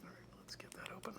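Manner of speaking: soft whisper under the breath while working; concentrated whisper over fiddly work. Real, not acted. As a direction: whisper to yourself as you fiddle. Quietly fiddling with tiny parts.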